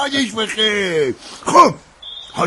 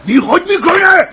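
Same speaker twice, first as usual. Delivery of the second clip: sharp, loud and much higher; furious retort, high and loud; angry outburst; 11 semitones higher, 7 dB louder than usual. Acted. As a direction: bursts out indignantly. Mirza angered at the idea.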